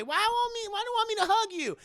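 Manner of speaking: mock Hattie whine; high Hattie voice. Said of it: "Hattie comes in".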